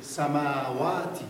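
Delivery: سماواتی drawn out slowly with open Arabic vowels; Arabic-style recitation. Shown as a demonstration. Teacher models the word for students, slow Arabic recitation. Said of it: "بخونید".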